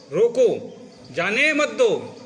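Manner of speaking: रोको on its own, falling; pause after रोको. Shown as a demonstration. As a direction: stretch जाने मत दो after a break at रोको. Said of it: "इसी शब्द को दूसरी जगह रुक जाओ".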